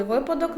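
«випадок» з наголошеним ВИ; виділи перше И в «випадок»; наголос на першому складі. Shown as a demonstration. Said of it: "наголос на першому складі". («випадок» з наголосом на початку)

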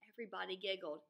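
usual speaking voice

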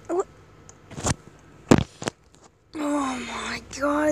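ordinary talk, nothing marked

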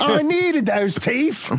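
slow high whine. Acted. Kid complains to dad.

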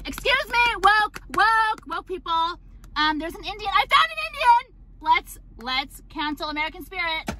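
high, fast parody of an outraged activist; mocking woke voice. Mocking woke people as she pretends to cancel cigarettes, high and fast. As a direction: squeal it like a scandalized activist.

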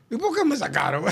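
high-pitched, laughing imitation; mock complaint. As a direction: imitate an aggrieved protest, amused. Retold complaint of sacked member.

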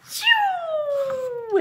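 very high sliding whistle; whoosh slide sound. Acted. Narrator imitates the lady's icy slide, high whistle. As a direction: zip up like sliding on ice.

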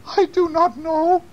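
put-on slow, very high old-lady voice; high-pitched storybook old woman. A narrator's reading.

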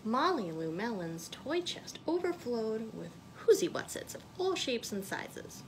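ordinary delivery, nothing marked

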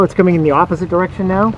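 ordinary talk

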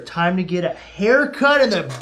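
ordinary talk

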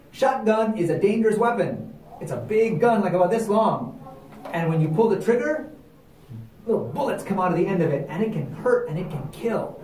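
plain reading voice

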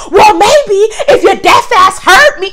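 high, slow, yelled insult; mock shout. Customer yelling at the clerk, high and slow. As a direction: yell it down at her, slow and nasty. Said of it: "So she said".

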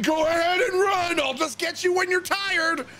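high, strained menacing shout; serial-killer taunt. Retold or imitated. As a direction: taunt fleeing prey, hammed up. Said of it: "Like I'm some serial killer".

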